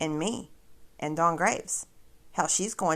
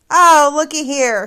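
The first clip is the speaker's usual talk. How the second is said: sarcastic gotcha; 7 semitones higher, 10 dB louder than usual. Real, not acted. Catching the viewers' attention.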